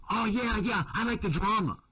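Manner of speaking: high sneering parody voice; high smug mock of youtubers who claim to enjoy the drama; mocking the gang.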